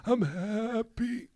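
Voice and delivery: high, slow, strained words near tears; lump in throat. Real, not acted. Telling worried friends he's fine, choked up. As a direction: squeeze out I'm happy. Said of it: "you have a huge lump in your throat".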